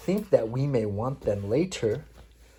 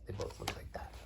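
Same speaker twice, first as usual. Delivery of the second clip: whisper; 4 semitones lower, 9 dB quieter than usual. Real, not acted. Parent whispering about the witches to child. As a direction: whisper it like a secret.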